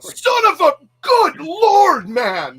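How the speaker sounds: very high, loud exasperated yell; mock irritated outburst. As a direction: imitate a friend blowing up in irritation. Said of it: "he would be just so irritated".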